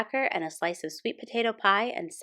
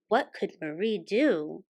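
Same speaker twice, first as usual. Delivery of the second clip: plain reading voice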